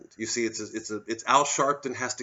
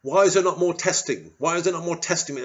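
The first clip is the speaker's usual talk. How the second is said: higher, louder mock whine of a politician; mocking Keir Starmer; 8 semitones higher, 7 dB louder than usual. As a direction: sneer out his weak question. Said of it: "where was he today".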